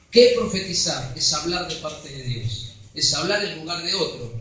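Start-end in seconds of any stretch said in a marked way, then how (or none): none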